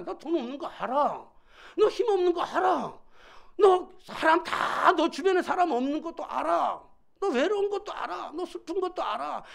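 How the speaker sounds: high, forceful preacher voicing God; impassioned divine reply. God answers reluctant Moses that He knows, impassioned and high.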